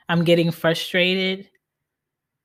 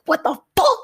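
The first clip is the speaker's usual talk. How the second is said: frustrated outburst; 13 semitones higher, 5 dB louder than usual. Retold or imitated. Acted outburst at work system.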